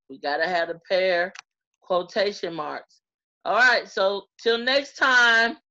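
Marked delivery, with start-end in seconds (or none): 3.4-5.6 cheerful sign-off. Real, not acted.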